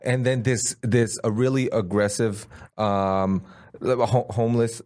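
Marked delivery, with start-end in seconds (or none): none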